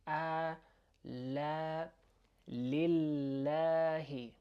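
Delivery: A, la, lillahi, all with a front a as in cat; A, la, lillahi, slow and separated, vowel kept thin; light a. Shown as a demonstration. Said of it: "it sounds light".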